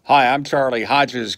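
usual speaking voice

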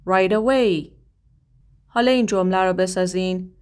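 ordinary talk, nothing marked

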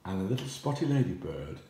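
usual speaking voice

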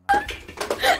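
high squealing laugh-cry; hysterical laugh. Real, not acted. Laughing fit.

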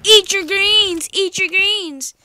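loud, slow drawn-out yell; shouting. Acted. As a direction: bellow it at the enemy. Yelling at opponent in game.

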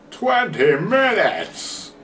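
"Twenty minutes!" drawn out slow and high; X Factor announcer voice. Shown as a demonstration. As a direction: boom "Twenty minutes!" like a TV talent show host. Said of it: "That was my X Factor voice".

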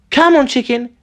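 high, inviting call; coaxing a chicken. A narrator's reading.

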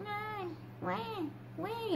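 faint, high, drawn-out newborn wails; small mock baby cries, spaced out; imitated soft baby cry. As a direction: imitate a weak newborn crying very softly. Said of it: "así, pero súper despacito".

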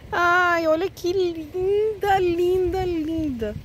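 high, slow, teary gushing; almost crying over something lovely; choked-up high admiring voice; near tears. Real, not acted. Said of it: "eu tô quase chorando".

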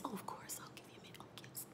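barely audible whisper; hushed deference. Acted. Lydia granting June a minute, in a deferential whisper. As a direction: whisper it as you back away politely. Voicing Lydia politely backing off.

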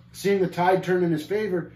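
ordinary delivery

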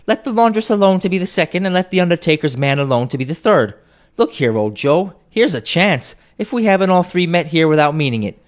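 put-on high, loud, brassy old woman; bold cockney woman. A narrator's reading. First woman hailing old Joe at the shop, high and loud. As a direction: crow it out like a brazen thief.

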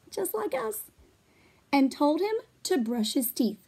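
ordinary delivery, nothing marked

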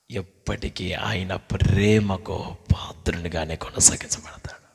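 soft, low intimate whisper to the congregation; hushed preaching. Acted. Preacher whispering tenderly to the congregation. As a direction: draw them close with a hush.

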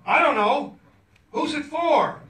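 high and loud, laughing through it; yelled question. Retold or imitated. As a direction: shout back across the shop. Shouted exchange with shopkeeper.

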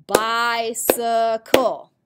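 "Bicycle" slow and loud in three beats, bi-cy-cle; syllable by syllable. Shown as a demonstration. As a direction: clap out each syllable of "Bicycle". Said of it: "What about a three-syllable word?".

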